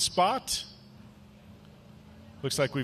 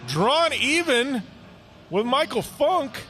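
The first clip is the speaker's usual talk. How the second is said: higher, slowing to stretch out the drama of the side-by-side; building race call; 8 semitones higher than usual. Real, not acted. Commentator calling Tobias drawing even with Funk, excited. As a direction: sell the moment they draw even.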